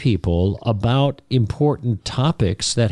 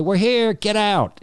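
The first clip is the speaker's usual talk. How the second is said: much higher mock cabin announcement; flight attendant; 10 semitones higher, slower than usual. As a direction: imitate a flight attendant, voice pitched way up. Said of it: "the flight attendant says".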